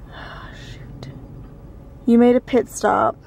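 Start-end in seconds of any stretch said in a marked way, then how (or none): 0.1-1.1 breathy whispered oh shoot; whispering. Real, not acted. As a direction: whisper it under your breath. Stumped while guessing restaurants.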